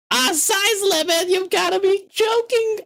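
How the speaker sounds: very high exasperated cry; outraged at the size limit; high-pitched exasperated yelp; frustrated disbelief. Real, not acted. Upload size limit blocks him.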